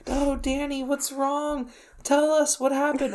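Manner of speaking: retold tearful concern. Crying friends plead with Danny on the roof, mock tearful. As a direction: imitate worried friends fussing over him. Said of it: "everyone is crying, and he, they're like".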